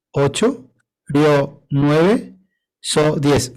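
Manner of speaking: each syllable followed by its count, rising; slow syllable-by-syllable count. Shown as a demonstration. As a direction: split the line into syllables, counting them. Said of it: "si vamos tres hasta desmayar".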